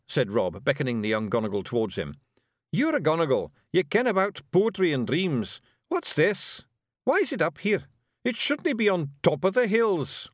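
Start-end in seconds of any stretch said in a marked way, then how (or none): 2.7-10.3 high, brisk Scottish little warrior; higher Scots brawler, impatient; Scottish pictsie leader. Acted. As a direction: bark it out like a tiny Scots chieftain. Rob questions Billy.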